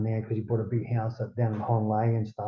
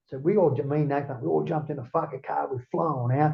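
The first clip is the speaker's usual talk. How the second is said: ordinary talk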